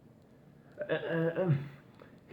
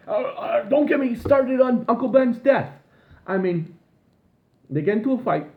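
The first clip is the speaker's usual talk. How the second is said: high, loud worked-up rant; exasperated rant; 10 semitones higher, 6 dB louder than usual. Real, not acted. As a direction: go off in disbelief. Ranting, voice shoots up.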